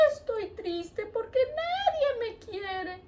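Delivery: a little puppet heart about to cry; sad heart. Acted. Heart puppet tells the teacher nobody loves it, soft and mopey. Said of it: "¿Por qué estás triste?".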